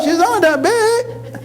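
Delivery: high, slow squeaky aside; small mother aside. Acted. Comedian describing his small mother in a high squeaky aside. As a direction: say it up small and sly.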